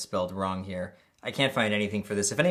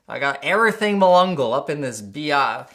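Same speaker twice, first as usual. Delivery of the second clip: hype-man voice; 4 semitones higher, 7 dB louder than usual. Pretend. Hammed-up hype about packages.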